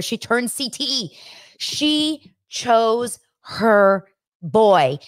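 slow, drawn-out emphasis; slow stress. Real, not acted. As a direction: stretch each word to land the shock. Stressing the shocking betrayal.

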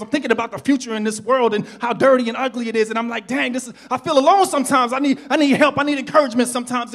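plaintive outpouring of retold loneliness; upset venting. Preacher replaying his complaint to God, upset venting. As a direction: vent it urgently, tumbling through each complaint. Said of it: "Nah, God, I'm upset".

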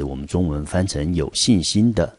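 usual speaking voice

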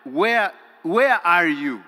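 loud slow searching call; preaching call. Acted. Preacher calling out to absent believers, loud and slow. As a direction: cry out to the missing worshipper.

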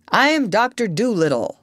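lightly put-on imitation of a doctor announcing himself; Doctor Dolittle. A narrator's reading. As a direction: announce yourself firmly, in another's voice. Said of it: "just the way the doctor would".

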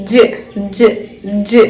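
short repeated ജ്; teacher demo of ജ്; j sound. Shown as a demonstration. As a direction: model the dge sound. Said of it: "അങ്ങനെ കേട്ടോ".

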